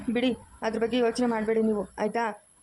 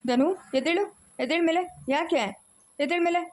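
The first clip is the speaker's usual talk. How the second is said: insistent urging; 6 semitones higher than usual. Acted. Urging kneeling brother to rise.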